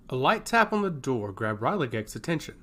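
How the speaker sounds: usual speaking voice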